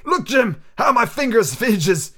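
a hint of a pleading old sailor; pleading old captain. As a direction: plead with the boy, fingers shaking. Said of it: "he continued in the pleading tone".

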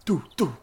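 high vocal pew noises; firing sound effect. Acted. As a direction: mimic projectiles launching with your mouth. GM makes weapon firing sound.

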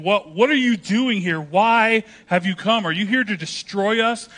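imitating a demon-possessed man's outburst; retold yelling. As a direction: yell it out as the possessed man. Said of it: "But he starts yelling".

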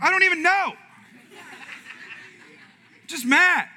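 loud, high-pitched retort to his wife; acted-out grumpy shout; exasperated outburst. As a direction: yell it as comic, baffled anger. Acts out unexplained morning anger at wife.

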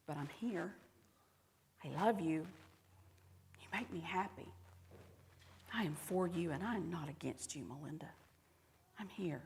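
very soft, hushed reassurance; near-whisper, pausing tenderly between phrases; tender whispering God. Acted. God reassuring a frightened woman, tender and hushed. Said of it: "God who whispers over me".

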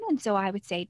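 ordinary delivery

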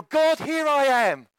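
slow, high mock-pious offering; mock prayer. Worshipper offering himself to God, mock-devout prayer. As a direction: intone it high and drawn out to God. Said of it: "we have a way of praying".

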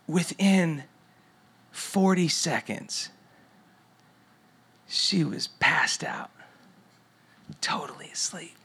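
slow hushed whisper so as not to wake her. Real, not acted. Not waking sleeping daughter.

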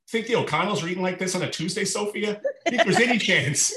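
high, laughing mock-boast; playful teasing. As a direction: tease her with a grin. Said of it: "I'd be like".

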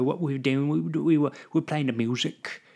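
slipping into a hammed-up British accent; playful attempt at British English; mock British accent. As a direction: put on a jokey English accent. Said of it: "I'm never doing a British accent".

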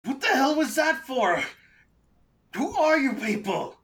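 high-pitched, indignant outburst; high voice of an angry prisoner; outraged captive. Acted.

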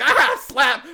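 high, loud blurted outburst; playful, sudden shout; mock yell. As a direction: imitate an actor blurting it mid-take. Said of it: "he yells out".

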